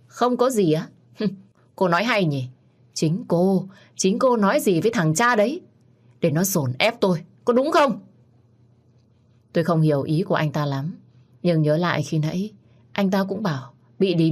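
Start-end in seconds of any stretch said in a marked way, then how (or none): 0.1-8.0 put-on a drunk man yelling at the woman he cornered; angry drunk shout. Drunk man angrily accusing her. (quát say xỉn, giọng cao và to)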